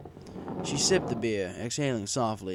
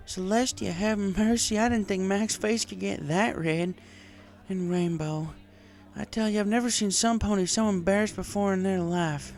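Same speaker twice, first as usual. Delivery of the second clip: higher country mare voice, slurring a touch; Applejack voiced as drunk farm mare; 11 semitones higher, 5 dB louder than usual. A narrator's reading. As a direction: play the tipsy farm girl venting.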